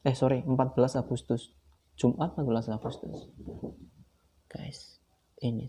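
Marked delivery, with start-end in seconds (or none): 4.5-5.7 whisper. Real, not acted. Showing the sale contract.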